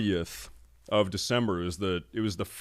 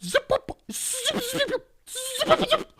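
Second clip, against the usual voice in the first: squeaky very high zips through a laugh; zipping imitation; slower than usual. Cyclists whizzing past his car, squeaky laughing zips. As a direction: mimic bikes darting past, laughing.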